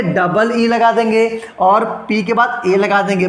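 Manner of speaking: ordinary delivery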